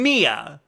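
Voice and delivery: Mia with a stretched, stressed MEE; wrong stress. Shown as a demonstration. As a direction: lean hard on the i in Mia. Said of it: "You're putting stress on the".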